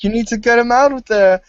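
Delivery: high, loud mock urgent order; urgent cartoon voice. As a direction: bark it like a panicked sidekick. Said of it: "it's like".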